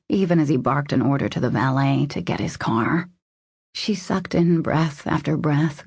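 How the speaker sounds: usual speaking voice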